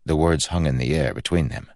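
plain reading voice

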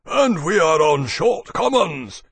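high, loud, slow big-creature voice; dragon Maximus, grumbling. Acted. Maximus complaining about the food, loud grumble. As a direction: complain in a drawn-out boom.

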